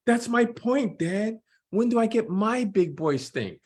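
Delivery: put-on young Jordan voice. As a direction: play the twelve-year-old son, earnest. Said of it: "I'm gonna try to do voices to distinguish".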